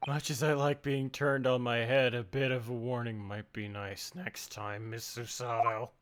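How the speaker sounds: deep character voice. Acted. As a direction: deliver it low and wry. Voicing a male character deep.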